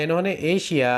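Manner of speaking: usual speaking voice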